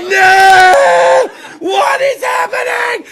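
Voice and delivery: shrieked, high disbelieving "No! What is happening?"; horrified shout. Acted. Comic watching the video, yelling in shocked horror. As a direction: yell it in shocked disbelief, slowing down. Shocked outburst in acted reaction.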